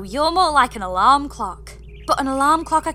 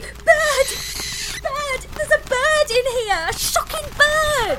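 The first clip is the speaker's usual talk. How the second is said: panicked yelling; 11 semitones higher than usual. Acted. Bird bursts out of the box.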